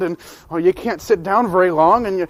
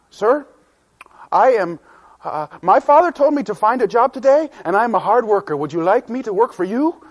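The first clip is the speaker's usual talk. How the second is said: put-on eager boy; 6 semitones higher, 5 dB louder than usual. Boy eagerly asks for a job.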